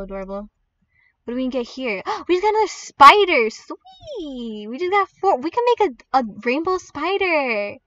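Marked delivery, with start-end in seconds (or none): 2.0-7.8 high, loud delighted squeal; real excitement. Real, not acted. Player landing another spider, excited squeal. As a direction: burst out thrilled at the lucky find. Thrilled by a lucky game drop.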